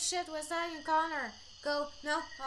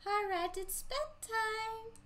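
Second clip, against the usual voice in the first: high, slow motherly bedtime voice; mom voice; 8 semitones higher, slower than usual. Acted. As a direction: play the mom tucking her kid in. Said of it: "I'm trying to do voices again".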